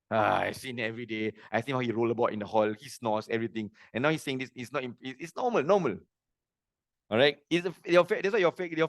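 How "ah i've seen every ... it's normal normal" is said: low-pitched, mildly bored imitation of a son; unimpressed son. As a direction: shrug it off as everyday stuff, voice dropped low. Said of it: "my son is not impressed".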